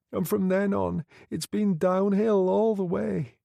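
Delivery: put-on high girlish voice, bitter and raw; bitter young woman. A narrator's reading. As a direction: voice the girl spilling out self-hate, pitched high. Girl tells of her abuse.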